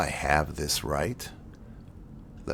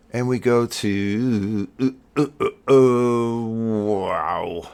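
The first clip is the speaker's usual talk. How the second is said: hammed-up excitement; 6 semitones higher, 8 dB louder, much slower than usual. Hyped scene switch to Earth.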